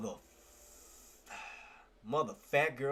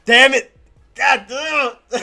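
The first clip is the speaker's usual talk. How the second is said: loud, higher groans of defeat; frustrated yell; 10 semitones higher, 18 dB louder, much slower than usual. Real, not acted. Trader yelling at his screen after a missed trade, frustrated. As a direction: let it burst out after the miss.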